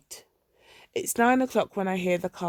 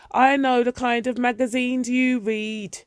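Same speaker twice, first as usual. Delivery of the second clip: accusing shout; 5 semitones higher, 6 dB louder than usual. A narrator's reading. Mother shouting accusations at night.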